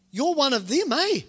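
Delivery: single him out, pitch climbing to the end; acted accuser's challenge, rising; mock accusation.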